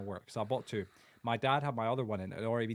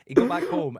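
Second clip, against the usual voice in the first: shouting; high and loud outburst; 13 semitones higher, 12 dB louder than usual. Real, not acted. Building anger in retelling.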